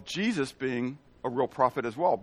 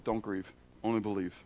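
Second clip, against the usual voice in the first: gentle comfort; 7 semitones lower, 10 dB quieter than usual. Retold or imitated. Jesus comforts grieving father.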